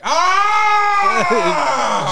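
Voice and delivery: high loud startled "Ah!"; mock scream. Adult in an arcade after farting, screaming. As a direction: let out a sudden high scream. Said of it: "Just scream".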